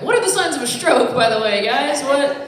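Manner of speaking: loud, high panicked blurt; mock panicked shout. Comic at a party yelling in mock panic. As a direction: yell it like you're having a stroke.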